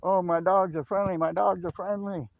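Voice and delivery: high, loud imitation of a panicked owner; retold yelling, pitched well up; mock scream. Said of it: "buddy came screaming out".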